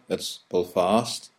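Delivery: Belfast said slow, squashed the local way; local Belfast pronunciation. Shown as a demonstration. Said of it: "don't even call it that".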